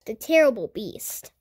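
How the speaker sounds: ordinary delivery, nothing marked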